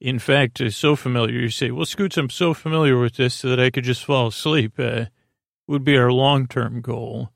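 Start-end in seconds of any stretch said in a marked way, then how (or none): none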